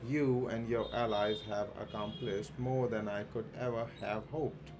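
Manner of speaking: ordinary talk, nothing marked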